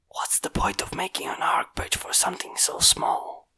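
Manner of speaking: soft unvoiced whisper to himself; whispering a puzzled aside. A narrator's reading. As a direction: murmur it under the breath. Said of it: "the man whispered to himself".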